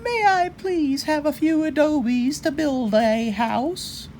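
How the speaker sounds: high, sweet little javelina asking politely; little javelina politely asks the brickmaker for adobes, high and sweet. Acted. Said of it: "thought for a moment and said".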